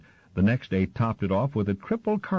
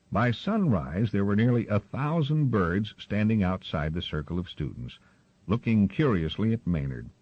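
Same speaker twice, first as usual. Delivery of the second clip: ordinary delivery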